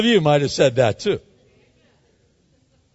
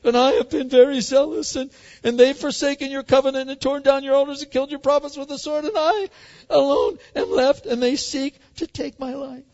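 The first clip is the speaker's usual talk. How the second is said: whiny pity party; 10 semitones higher than usual. Pretend. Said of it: "Elijah goes through it".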